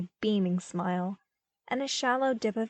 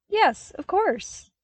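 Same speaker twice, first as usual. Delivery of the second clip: eager agreement; 10 semitones higher, 4 dB louder, slower than usual. A narrator's reading. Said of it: "agreed immediately".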